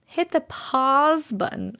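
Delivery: slow, careful "pahz" for "pause"; effortful "pahz" in "pause"; other people's pronunciation. Shown as a demonstration. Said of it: "that's really hard".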